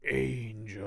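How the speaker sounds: soft, slow monster answer; imitated monster, drawn out. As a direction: answer like a dazed creature. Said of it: "Do you know who she is?".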